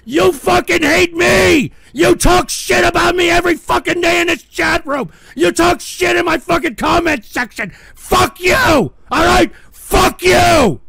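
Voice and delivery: real raging outburst; furious shout. Real, not acted. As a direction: yell at the haters in full rage. Raging at chat.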